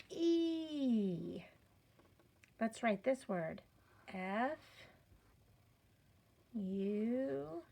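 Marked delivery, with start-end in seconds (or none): 0.1-1.4 "E" held long, higher, sliding down; long E sound. Shown as a demonstration. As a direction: stretch "E" out as one sustained "ee". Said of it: "let's look at when it says".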